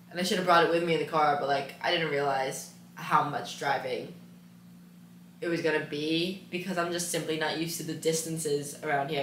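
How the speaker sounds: ordinary talk, nothing marked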